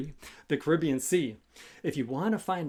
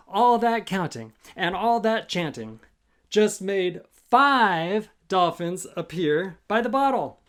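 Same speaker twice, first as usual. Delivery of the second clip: usual speaking voice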